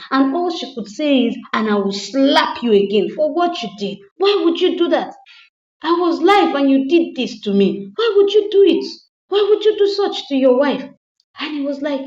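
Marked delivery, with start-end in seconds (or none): 1.5-10.9 high, loud indignant yelling; angry wife. Retold or imitated. Wife yelling at slapped husband, defiant and loud. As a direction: yell back, refusing to back down.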